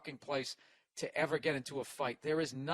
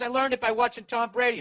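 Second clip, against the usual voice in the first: mocking heckler; 7 semitones higher, 6 dB louder than usual. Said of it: "He goes".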